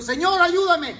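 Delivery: cry for help. Retold or imitated. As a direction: plead to be saved. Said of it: "un grito fuerte de convicción". (grito fuerte de súplica)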